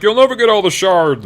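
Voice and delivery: high, loud villain taunt; a hammed-up high witchy villain; gloating Magda. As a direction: sneer it from on high. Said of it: "she's like".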